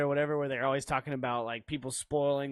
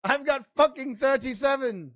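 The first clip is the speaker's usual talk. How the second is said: Legolas; 9 semitones higher, 5 dB louder than usual. Acted. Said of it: "Whatever Legolas said".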